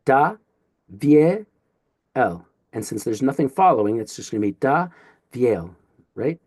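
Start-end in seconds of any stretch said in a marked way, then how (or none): 0.1-2.5 "Da, vie, el" in separate pieces; syllable by syllable. Shown as a demonstration. Teacher breaks up a Greek name, "Da, vie, el" syllable by syllable. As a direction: pause after each of "Da", "vie", "el". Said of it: "that's where we'll syllabify".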